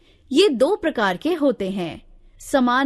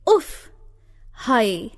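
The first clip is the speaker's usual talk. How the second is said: pained uff; much slower than usual. Shown as a demonstration. Teacher voices pain interjections.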